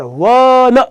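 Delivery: વન drawn out as waa-na; first sound stretched. Shown as a demonstration. Teacher pointing students to the very first sound, વન sounded out slowly. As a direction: hold the opening wa of વન, then add na. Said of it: "સૌથી પહેલો ઉચ્ચાર".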